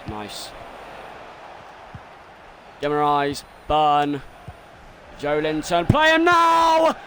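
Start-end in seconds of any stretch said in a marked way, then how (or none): 5.9-6.9 high, loud, drawn-out yell; long urgent holler; urgent shout. Real, not acted. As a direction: yell for the pass, stretching the last word. Calling for a pass.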